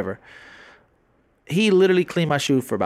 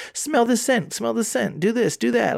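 high pushy sales pitch; mall kiosk seller; 9 semitones higher than usual. Retold or imitated. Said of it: "they always, like".

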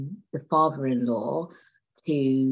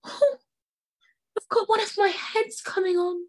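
feigned headache. Acted. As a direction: squeal it as the girlfriend faking a headache. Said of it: "She's like".

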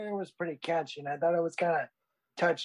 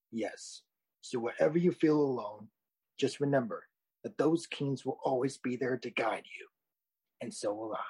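Mufasa; 3 semitones lower than usual. Acted. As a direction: reassure him like a wise old king. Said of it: "you sound just like Mufasa from The Lion King. Very noble and wise".

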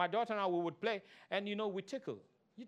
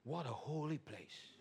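mock hushed awe; 7 semitones lower, 10 dB quieter, slower than usual. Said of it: "you walked in, you".

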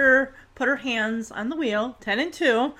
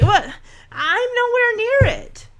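high-pitched protesting denial; imitated indignant daughter; 9 semitones higher than usual. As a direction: protest like an exasperated learner driver.

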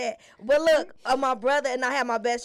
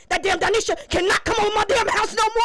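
higher, louder annoyed mother; fed-up mom; 8 semitones higher, 6 dB louder than usual. Retold or imitated. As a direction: mom laying down the law, irritated. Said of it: "She just said".

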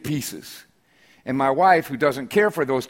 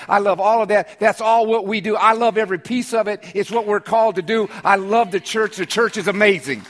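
raised, ringing preacher's cadence; preaching at full force; 5 semitones higher than usual. Acted. Pastor to congregation on loving the church, full preaching voice. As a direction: build the sermon to a rolling climax.

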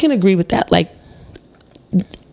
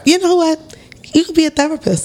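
high playful tease; teasing excited; 11 semitones higher than usual. Real, not acted. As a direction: tease her brightly. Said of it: "teasing friend, high pitch".

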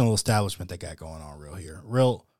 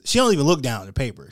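ordinary talk, nothing marked

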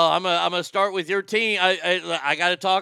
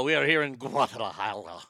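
news guy; 6 semitones lower than usual. Pretend. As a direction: lower mock newsman voice. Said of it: "sound like a, a news guy".

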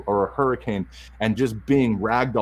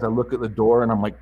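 ordinary talk, nothing marked

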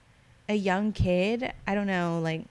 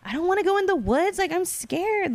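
scared; 10 semitones higher, 4 dB louder than usual. Retold or imitated. Retold dream, refusing to enter woods.

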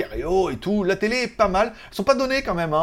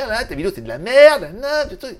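loud scornful jab, then higher; mocking complaint; 6 semitones higher, 6 dB louder than usual. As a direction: sneer it like a hater. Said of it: "Tout le monde me dit".

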